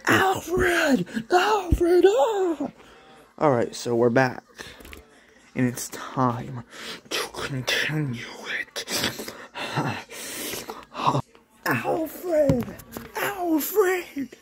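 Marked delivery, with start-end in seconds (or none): 0.1-2.7 very high, loud cry for Alfred; shrill calling out. Pretend. Mock calling for the butler, very high. 7.1-11.2 playful whisper. Real, not acted. Vlogger whispering to viewers. 11.7-14.3 very high cry for Alfred; shrill calling out. Pretend. Mock calling for the butler, very high.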